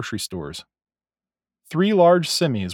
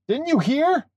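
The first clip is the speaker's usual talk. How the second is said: high-pitched call across a crowd; shouted; 14 semitones higher than usual. A narrator's reading. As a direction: call it out from a distance. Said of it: "shouted Jake".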